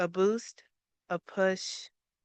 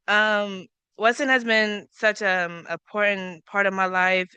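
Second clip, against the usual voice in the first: normal talking voice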